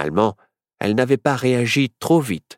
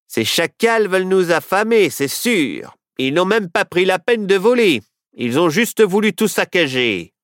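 sheriff voice; 8 semitones higher than usual. A narrator's reading. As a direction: play the sheriff, pitched up and brash. Sheriff character voice.

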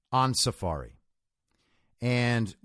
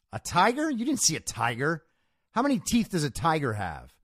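higher-pitched, incredulous questioning; mock disbelief, pitched up at the start; sceptical challenger; 4 semitones higher than usual. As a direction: challenge the claim as a doubting camper. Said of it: "obviously a communist, stood up and said".

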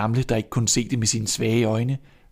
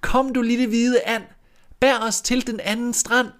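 much higher sing-song call; chanted rhyme to the duck; 13 semitones higher than usual. Acted. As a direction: call to the duck across the water. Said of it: "Så råbte hun".

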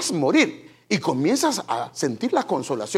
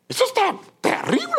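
much higher cry of dismay; dismayed exclamation. Real, not acted. Preacher picturing a mother setting her baby on the river, much higher. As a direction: exclaim that it's terrible.

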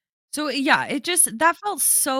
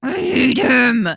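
emphatic; 2 semitones higher, 7 dB louder than usual. Real, not acted. Repeating it with emphasis.

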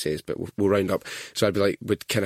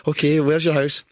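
louder, higher dispatcher voice; taxi operator; 7 semitones higher, 5 dB louder than usual. Retold or imitated. Taxi operator to a deaf old caller, raised voice. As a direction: speak up for a customer who can't hear. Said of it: "they'd be like".

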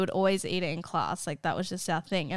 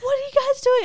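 high, loud mock-outraged teacher; imitating furious teacher; 6 dB louder than usual. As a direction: scold them like a teacher losing it. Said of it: "like, just losing her mind".

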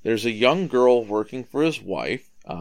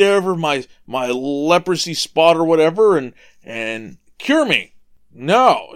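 indignant rant, louder and higher; mad Naaman; 5 semitones higher, 5 dB louder than usual. Retold or imitated. Proud captain fuming at Elisha's instructions, indignant and loud. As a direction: huff at the insult to his status. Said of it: "And he's mad".

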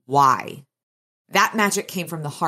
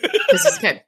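laughing out the words, pitched way up; 5 dB louder than usual. Real, not acted. Host repeating co-host's joke, laughing. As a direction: crack up mid-line.

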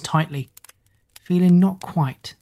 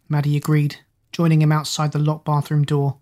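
usual speaking voice

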